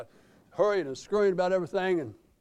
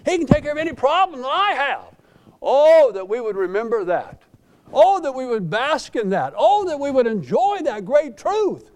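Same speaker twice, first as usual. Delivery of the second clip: loud, higher, impassioned sermon delivery; fervent preaching; 5 semitones higher, 8 dB louder than usual. Acted. Pastor exhorting his congregation about God's power, loud fervent preaching. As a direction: lift the room with every line.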